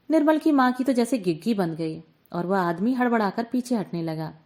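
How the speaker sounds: ordinary delivery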